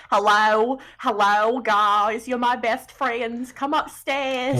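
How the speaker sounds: a light mock Australian lilt; odd put-on accent. [name] greeting the party from the tower, in a lightly put-on Australian accent. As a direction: drawl the welcome like a hammy Aussie host. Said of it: "What is happening with your accent?".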